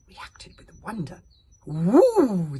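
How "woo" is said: loud chorused woo; wondering crowd woo. A narrator's reading. Superstitious crowd chorusing in wonder. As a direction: coo it in awe together. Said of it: "they chorused".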